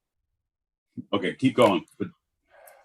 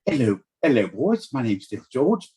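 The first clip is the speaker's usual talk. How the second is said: put-on lilting British accent, rising at the end. George greeting the brothers their way, put-on British. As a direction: greet them with affected British airs.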